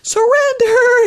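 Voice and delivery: slow, high, drawn-out Surrender; calm inner voice. Retold or imitated. Bride telling herself to let go, slow and calm. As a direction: stretch it out like a mantra. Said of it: "But then I was like".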